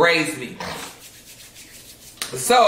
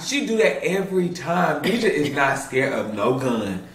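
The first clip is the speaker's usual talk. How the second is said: normal talking voice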